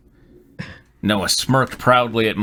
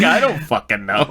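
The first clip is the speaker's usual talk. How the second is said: laughing; 4 semitones higher than usual. Retold or imitated. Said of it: "I was like".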